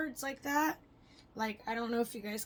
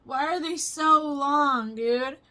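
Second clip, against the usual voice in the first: loud, slow shocked complaint; retelling her shock at the long teeth; loud disbelief; 4 semitones higher, 11 dB louder, much slower than usual. Retold or imitated. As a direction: belt out the shock, drawn out. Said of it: "I was like".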